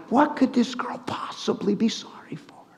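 hushed, perplexed whisper. Retold or imitated. Preacher recalling his puzzlement at wife's apology, perplexed whisper. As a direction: whisper it in bewilderment, as if to yourself. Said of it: "I was perplexed".

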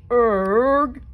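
monster's disgust. A narrator's reading. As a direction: spit out the disgust. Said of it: "the monster says".

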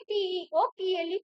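usual speaking voice

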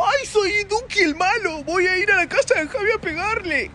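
high, slow, mincing mock of a tough guy. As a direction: sneer at him by playing him as a silly villain. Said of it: "[name], ¿qué hizo?".

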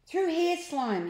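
high, loud call to a friend. A narrator's reading. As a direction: call your friend over urgently. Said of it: "he called".

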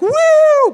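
very high, loud whoop; cheering whoop. Shown as a demonstration. Preacher showing the congregation how to cheer, whooping. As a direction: whoop like a crowd. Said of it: "I thought you would have been like".

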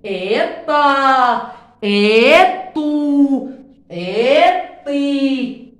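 slow loud long-vowel drill; long vowel demo. Shown as a demonstration. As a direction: hold each long vowel for the class to hear. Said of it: "اطا مديتها لأنه الآن مد طويل".

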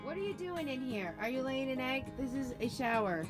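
normal talking voice

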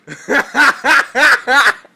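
loud, high, staccato cackle; high cackling laughter; loud laughing. Real, not acted. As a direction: burst into big rhythmic laughs. Pranking mom with loud laughter.

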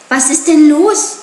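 high, loud startled question; narrator's acted shock, pitch jumping up. A narrator's reading. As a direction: ask it in sudden alarm. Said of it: "fragte Mowgli erschrocken".

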